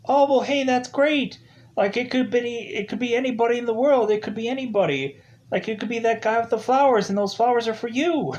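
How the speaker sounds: high, excited retold quote; mock-enthusiastic movie dialogue, quick and high; upbeat imitation. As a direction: play him brightly upbeat, pitched well up. Voices Joe's upbeat reply.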